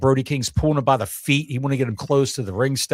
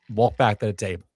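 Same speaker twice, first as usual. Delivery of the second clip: ordinary talk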